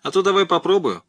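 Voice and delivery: выше и громче, с насмешливым вызовом; подначить старика саблей, с издёвкой повыше; издёвка. Acted. Махновец издевается над профессором. (насмешливый вызов)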